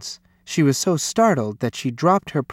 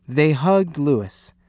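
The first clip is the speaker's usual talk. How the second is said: ordinary delivery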